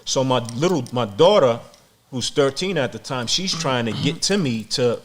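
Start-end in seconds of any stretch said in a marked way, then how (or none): none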